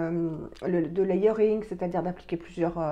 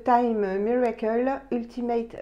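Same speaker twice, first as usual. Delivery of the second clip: slow, careful put-on English accent; reluctant English accent on the product name; 3 semitones higher, slower than usual. Real, not acted. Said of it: "j'ai horreur de prendre un accent anglais".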